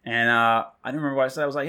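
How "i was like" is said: ordinary delivery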